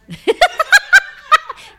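loud, high burst of laughter; shrieking laugh. Real, not acted. Reacting to friend's joke with a shrieking laugh. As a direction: let a big squeal of laughter out.

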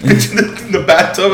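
laughing. Real, not acted. Cracking up while recalling the picture.

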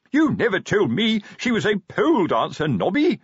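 high, loud dismayed wail. A narrator's reading. Sergeant confronting corporal about his girl, wailing. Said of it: "Fred wailed".

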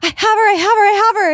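high, loud, repeated in a panic; hammed-up freaking-out caller; frantic older lady. Imitating the stranger who caught the dog, freaking out, high and loud. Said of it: "she's, like, freaking out".

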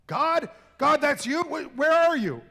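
high, loud, startled stammering; frightened stammer. Acted. Man hanging from a branch answers a voice, frightened and high. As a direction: panic at an unseen voice.

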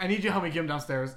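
loud, hurried call for help; retold demand, loud and rushed; urgent plea. Roommate begging a friend to help carry a drunk man, loud urgent plea.

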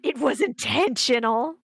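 loud, drawn-out protest; mock outrage. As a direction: cry it out in exaggerated indignation. Mock outrage over the gasp moment.

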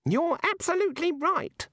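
a high mild snap; lightly snapped high god line; snapping god. A narrator's reading. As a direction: snap it eagerly in a squeak. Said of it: "snapped the god".

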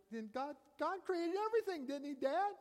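put-on a light high child's voice, pleading; retold child. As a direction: lightly voice the boy asking Dad. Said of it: "He goes".

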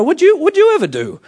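high, loud confronting voice; God imitating the director's put-down; director-style challenge. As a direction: throw it at him, high and loud. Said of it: "the same way that the director spoke".

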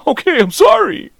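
very high, squeaky surrender; hammed-up meek protest; mock cowed apology. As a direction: apologise in a put-on high voice, as if scolded. Acting own cowed apology.

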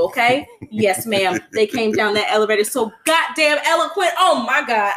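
loud and fired up, ready for the show; loud hyped retelling. Retold or imitated. Fan at the concert, hyped for [name], loud. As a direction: shout it like a fan who paid for this.

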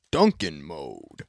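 deep, dragged-out announcer punchline; low slow voice. Pretend. Jingle punchline.

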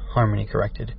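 ordinary delivery

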